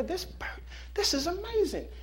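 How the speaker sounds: amazed. Acted. Customers amazed to owner.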